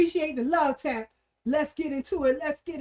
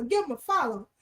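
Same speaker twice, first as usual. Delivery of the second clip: usual speaking voice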